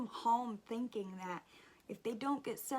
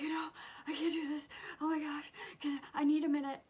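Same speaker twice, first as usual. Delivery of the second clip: louder, higher imitated panic; retold freak-out; 5 semitones higher, 5 dB louder, faster than usual. Mom imitating her son as nurse readies test, freaking out. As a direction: mimic a kid's mounting panic, hurrying at the end. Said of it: "starting to freak out".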